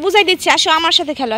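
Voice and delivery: normal talking voice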